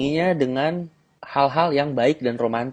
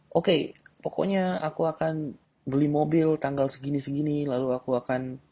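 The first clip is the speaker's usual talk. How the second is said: higher, softer boasting; girl boasting she'll buy a car, lightly voiced; mock confident girl; 3 semitones higher, 5 dB quieter than usual. Shown as a demonstration. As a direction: boast your plans sure of yourself.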